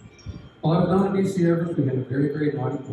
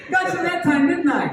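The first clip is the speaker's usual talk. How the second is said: put-on gloating kid laugh; 12 semitones higher, 4 dB louder than usual. Boy gloats over his prank.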